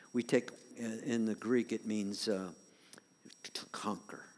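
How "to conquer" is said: hushed emphasis. Real, not acted. Whispered key word.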